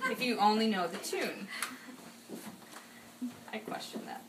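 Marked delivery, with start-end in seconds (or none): 3.5-4.2 breathy whispered aside to himself. Real, not acted. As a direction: drop to a whisper, just for yourself. Whispered aside mid-speech.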